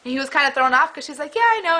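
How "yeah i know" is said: bright, cheery agreement, pitched much higher. Retold or imitated. Radio host cheerily agreeing with angry caller. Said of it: "she was cheery like".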